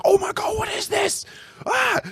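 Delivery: very high, panicked mock shriek; retold freak-out. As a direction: act out a hammed-up panic at a horrifying discovery. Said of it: "And I have a freak out".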